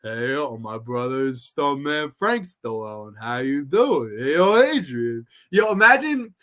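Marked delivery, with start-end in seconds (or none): 0.0-5.2 low, slow drawling impression; lumbering low voice, words stretched out; Stallone. Joking he is Stuntman Mike's brother, low slow impression. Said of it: "if he was Stuntman Mike".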